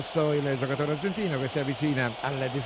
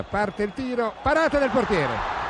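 excited save call; 6 semitones higher, 4 dB louder, slower than usual. Real, not acted. Save called out.

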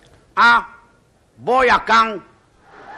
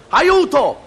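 loud high panicked yell of Aiuto!; shouting for help; 11 semitones higher, 6 dB louder than usual. Acted. Drowning man on a sinking boat shouts to the shore, loud panicked yell. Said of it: "grida l'uomo che ci stava sopra".

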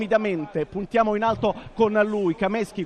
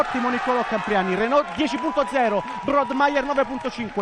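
excited; 6 semitones higher than usual. Real, not acted. Good shot in a shooting final.

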